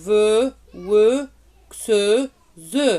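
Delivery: crisp teacher syllables, one by one; syllable drill. Shown as a demonstration. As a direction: drill ve, we, xe, ze clearly for the class. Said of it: "vous devez faire la même chose, répéter les syllabes". (syllabes articulées une à une)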